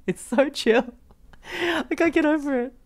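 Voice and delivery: ordinary delivery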